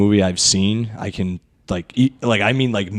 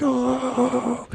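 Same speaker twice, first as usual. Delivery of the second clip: much higher, airy taunting oh; a squeaky teen raptor's oh; high mocking raptor voice; 12 semitones higher, 6 dB quieter than usual. Velociraptors reacting in voice.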